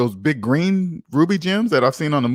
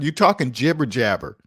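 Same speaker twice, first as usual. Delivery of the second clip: ordinary talk, nothing marked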